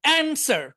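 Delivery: American front "a" at the start of অ্যানসার; American pronunciation. Shown as a demonstration. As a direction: model অ্যানসার with "an" as in "ant". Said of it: "American উচ্চারণ হবে".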